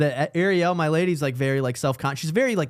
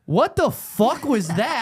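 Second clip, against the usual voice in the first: slow, higher-pitched stunned reaction; mock disbelief; 4 semitones higher, much slower than usual. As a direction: draw it out as if appalled. Group's stunned reaction, retold.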